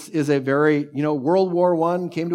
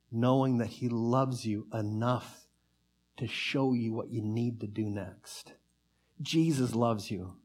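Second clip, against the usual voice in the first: soft tender; 4 semitones lower, 8 dB quieter than usual. Real, not acted. Tender soft repetition in sermon.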